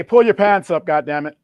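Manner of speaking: a mock scold, a touch of Obama; loud mock lecture to young men. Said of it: "He's gonna say".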